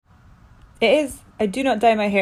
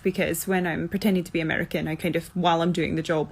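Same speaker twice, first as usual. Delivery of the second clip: usual speaking voice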